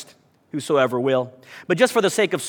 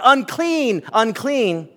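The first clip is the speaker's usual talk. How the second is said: mock yell; 5 semitones higher, much slower than usual. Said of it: "lepers would have to yell out".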